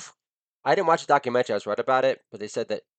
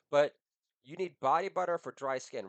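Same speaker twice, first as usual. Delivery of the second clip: normal talking voice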